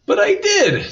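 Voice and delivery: put-on high, loud child's protest; indignant protest. A narrator's reading. Miranda objects to her teacher that she tried, indignant protest.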